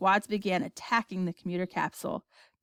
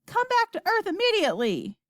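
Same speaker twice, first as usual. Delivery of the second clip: high, urgent command; shouting; 12 semitones higher than usual. A narrator's reading. President ordering an immediate return, shouting. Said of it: "shouted the president".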